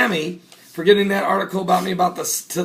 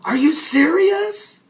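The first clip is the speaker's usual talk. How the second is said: slow, high stretched "serious"; milk the disbelief, slow and high; exaggerated disbelief; 8 semitones higher, slower than usual.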